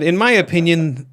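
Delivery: high, loud mock Irish lady; clumsy Irish lady impression; Irish lady accent. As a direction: put on a bad Irish brogue for the lady. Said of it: "I can't do an Irish lady".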